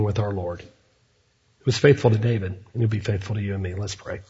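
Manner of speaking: hushed, fading pastoral close, drawing toward prayer; soft sermon close. Acted. Pastor closing his sermon to the congregation, hushed and fading. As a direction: let the voice sink and soften as you close.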